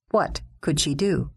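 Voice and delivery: plain reading voice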